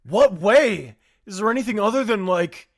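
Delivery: high, loud startled questioning; alarmed. Acted. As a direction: ask in shock. Voicing alarmed character.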